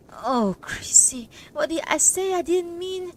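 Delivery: breathless, high, upset whisper; distressed plea. A narrator's reading. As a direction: gasp it out in dismay. Distressed apology to the mother.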